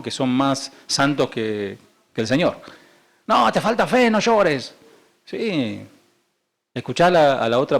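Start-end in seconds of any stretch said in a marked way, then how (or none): 3.3-4.7 high-pitched mock reproach; mock scolding friend. Preacher mimicking Job's friends to the congregation, mock reproach. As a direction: imitate a holier-than-thou friend telling someone off.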